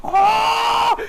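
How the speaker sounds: a gamer yelling at his screen in delight; retold shout. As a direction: scream it in hype at the big moment. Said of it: "you just go".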